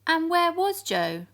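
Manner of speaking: anxious storyteller; worried. A narrator's reading. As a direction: gasp out the question. Narrator voices alarm.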